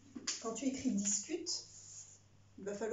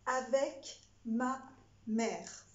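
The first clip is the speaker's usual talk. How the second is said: dictating; 5 dB louder, much slower than usual. Shown as a demonstration. Dictée read to pupils.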